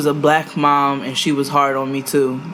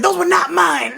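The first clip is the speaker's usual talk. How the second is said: high laughing protest. Retold or imitated. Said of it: "And I was like".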